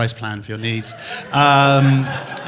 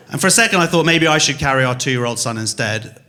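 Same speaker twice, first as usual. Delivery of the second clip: ordinary talk, nothing marked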